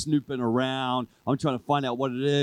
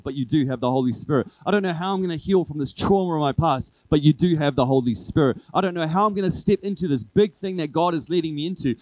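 louder, driving preacher's build; preaching at full force; 2 semitones higher, 5 dB louder than usual. Acted. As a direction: build the sermon to a rousing peak.

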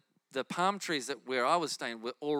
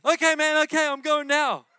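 higher and louder eager agreement; excited callers; 10 semitones higher, 10 dB louder than usual. Retold or imitated. Callers answering the preacher, eager and loud. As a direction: say it like thrilled guys on a phone.

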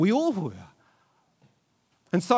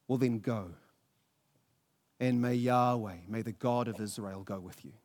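quiet blessing; 7 semitones lower, 6 dB quieter than usual. Acted. Defeated king giving his blessing.

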